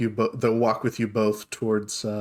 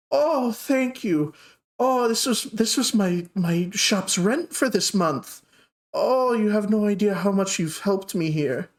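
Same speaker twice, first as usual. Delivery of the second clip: put-on grateful old lady; 8 semitones higher than usual. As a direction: gush thanks as a sweet little old lady. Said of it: "she's, like, shocked for a moment".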